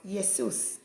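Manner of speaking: Jesus said as "Yesus", with a Y. Shown as a demonstration. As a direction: slowly say "Jesus" with Y replacing the J. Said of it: "it's not pronounced Jesus".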